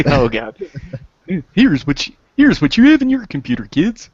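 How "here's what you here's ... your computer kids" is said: much higher, faster purple dinosaur voice; Barney voice. Pretend. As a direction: sing it out like Barney to kids. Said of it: "would have sounded better if Barney would have said it".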